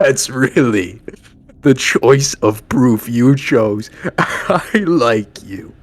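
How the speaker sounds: bursting out laughing. Acted. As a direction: play a character tickled by the visitor's nerve, laughing. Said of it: "he bursts out laughing".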